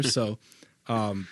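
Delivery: ordinary talk, nothing marked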